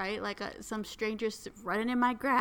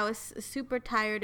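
ordinary delivery

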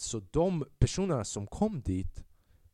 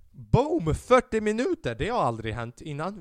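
excited; 8 semitones higher, 6 dB louder than usual. Real, not acted. Celebrating podcast length.

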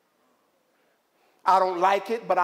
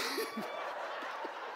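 burst of laughter; 11 semitones higher, 14 dB quieter than usual. Real, not acted. Laughing at the memory.

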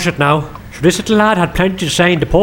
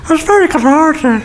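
higher, teasing mimic of "It's very cathartic" in his friend's voice; imitating Tommy; 7 semitones higher than usual. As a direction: parrot his friend back, pitched up and mocking. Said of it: "That's what you'd say, Tommy".

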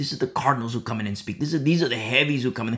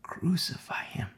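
much softer, almost whispered crowd cry; whispered imitation of crying out; hushed mock outcry; 9 dB quieter than usual. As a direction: act out a crowd's cry under your breath. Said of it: "So they cried out again".

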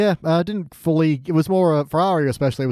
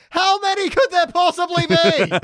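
much higher, disbelieving; incredulous squeak; 14 semitones higher than usual. Real, not acted. Podcaster mocking the guards' question, high incredulous squeak. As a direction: rise way up in disbelief.

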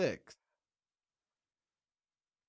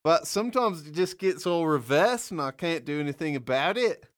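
louder bad Aussie accent sliding into English; wobbly mock-Australian accent; Australian accent slipping English; 4 semitones higher, 7 dB louder than usual. Shown as a demonstration. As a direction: start Australian, drift toward England as you plead. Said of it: "your accent was good for half".

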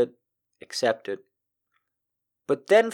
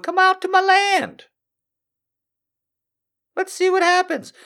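much higher, wheedling creep voice; creepy cult guy; 6 dB louder than usual. Retold or imitated. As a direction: lure them like a sleazy cult leader. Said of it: "some guy going".

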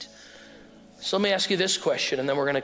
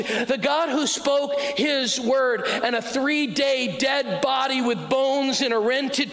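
preaching at full force; 9 semitones higher than usual. Acted. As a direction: proclaim it slowly to the crowd, rising. Sermon climax preaching.

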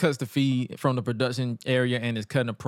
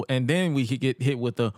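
usual speaking voice

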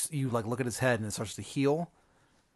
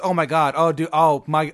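mock panic, louder and higher; freaking out; 4 semitones higher, 8 dB louder than usual. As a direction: play someone flustered and freaking out. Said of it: "he kinda, like, freaks out".

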